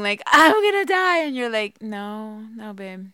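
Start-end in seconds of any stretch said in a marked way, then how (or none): 0.3-1.2 louder, higher mock scream of "I'm gonna die"; retold scream. Wife replays her labor scream of "I'm gonna die" to her husband. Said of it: "here I am screaming". 1.8-3.1 calm husband. Retold or imitated. Wife imitates her husband calmly soothing her in labor. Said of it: "you're so calm".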